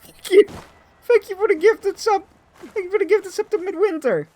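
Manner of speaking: very high, cartoonish thanks; high squeaky voice. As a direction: thank them in a chipmunk voice. Thanking a gifted sub, voice raised.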